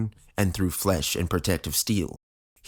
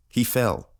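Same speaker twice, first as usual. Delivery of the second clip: ordinary delivery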